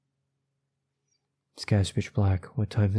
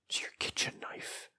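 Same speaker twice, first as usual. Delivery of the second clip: soft whispered reveal; ominous whisper; 13 dB quieter than usual. A narrator's reading. Narrator revealing the knife on the floor, ominous whisper. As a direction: whisper the dreadful discovery.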